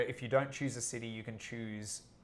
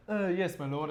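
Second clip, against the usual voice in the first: lightly voiced servant; 5 semitones higher than usual. Acting out a servant figure.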